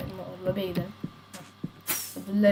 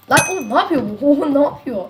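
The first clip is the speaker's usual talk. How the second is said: louder, higher, laughing at the chaos; laughing disbelief; 5 semitones higher, 7 dB louder than usual. Real, not acted. Streamer watching a rival's crazy move, laughing outcry. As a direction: cry out in amused disbelief.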